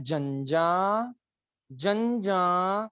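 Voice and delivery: जंजा stretched very slowly with a rise; slow model syllable. Shown as a demonstration. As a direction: drag out जंजा for the class. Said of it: "तो कैसे pronounce करना है?".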